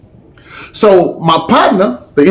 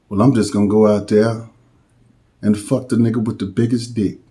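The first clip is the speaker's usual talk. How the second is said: much lower and softer, half under the breath; low mutter; 7 semitones lower, 8 dB quieter than usual. Retold or imitated. The little dude muttering his crude reply, low and soft.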